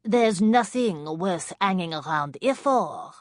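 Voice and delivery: put-on slow, loud, heavy French accent; thick French accent. A narrator's reading. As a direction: introduce yourself in smoky French. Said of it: "in a French accent as thick as nightclub smoke".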